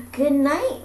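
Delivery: high, loud call downward; yelled down. Acted. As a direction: yell good night down to the fairy. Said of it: "we yelled down".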